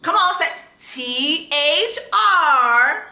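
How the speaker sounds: pointed slow spelling for the chat; spelled out slowly. Shown as a demonstration. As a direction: spell each letter out, drawn out. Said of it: "does anybody in the chat wanna spell it out?".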